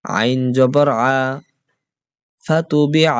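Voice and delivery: teacher's chanted letter-by-letter recitation; spelling drill. Shown as a demonstration. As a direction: spell out each syllable for the class to repeat. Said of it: "তাড়াতাড়ি হবে".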